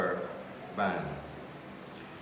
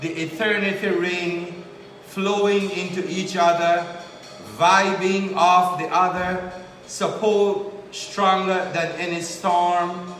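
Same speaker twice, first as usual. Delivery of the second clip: measured, louder spoken-word poem delivery; higher, projected poetry reading voice; poetry recitation; 7 semitones higher, 8 dB louder, slower than usual. Acted. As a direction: recite it line by line with deliberate pauses.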